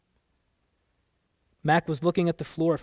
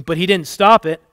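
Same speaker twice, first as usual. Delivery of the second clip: loud, forceful retort; bitter accusation; 3 semitones higher, 11 dB louder than usual. A narrator's reading. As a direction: throw it back at her angrily. Father interrupts with bitter accusation.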